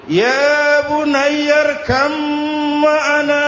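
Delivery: high, stretched-out calling; gaya orang memanggil. Shown as a demonstration. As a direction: hold each word long as if calling someone far away. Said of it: "Ini gaya orang manggil". (seruan tinggi yang dipanjangkan)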